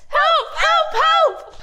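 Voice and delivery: high, playful mock shout; shouted cry for help. Shown as a demonstration. Teacher and kids shout the Israelites' line, high and playful. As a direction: shout it out like a desperate crowd. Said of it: "let's shout the line together".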